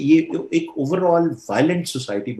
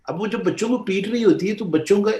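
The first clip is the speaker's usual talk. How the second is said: ordinary talk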